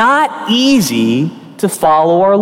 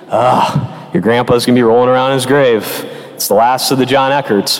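grumbling grandpa; 8 semitones lower than usual. Retold or imitated.

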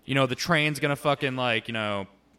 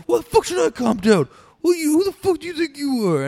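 high-pitched, full-volume mock screaming; imitated drunken outburst; 11 dB louder than usual. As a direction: explode like a drunk told to settle down. Drunk friend exploding when told to calm.

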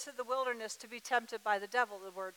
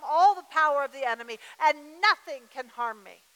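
loud, forceful rebuke of the enemy; bold declaration; 5 semitones higher, 9 dB louder than usual. Shown as a demonstration. Preacher showing the church how to rebuke the enemy, loud and forceful. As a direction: proclaim it like you mean it.

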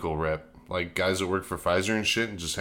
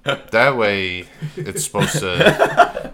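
high, loud, slow laughing; 4 semitones higher, 9 dB louder, much slower than usual. Real, not acted. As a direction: laugh through the words. Laughing while explaining.

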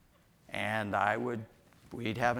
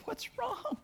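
put-on high soft coo to the baby; high baby-talk voice; 11 dB quieter than usual. Father soothing crying baby.

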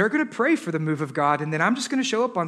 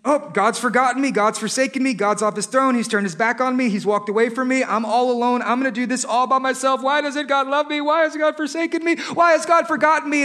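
mock despair; 6 semitones higher, 5 dB louder than usual. Acted despairing complaint, building.

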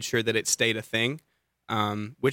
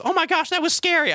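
much higher, imitating an excited child; scared little kid. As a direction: gasp it out like a thrilled kid. Kid's reaction imitated.

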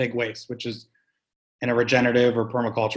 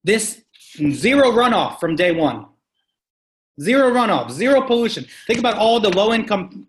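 passionate emphasis; 8 semitones higher, 4 dB louder than usual. Real, not acted. Passionate advocacy, rising.

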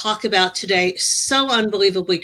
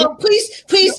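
alarmed pleading; 10 semitones higher, slower than usual. Real, not acted. Host begs guest on roof.

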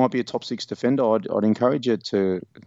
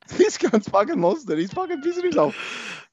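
high, exasperated laughing rant; mock rant; 14 semitones higher than usual. Podcast host ranting about a listener holding Butters, mock outrage. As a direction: rant in hammed-up disbelief.

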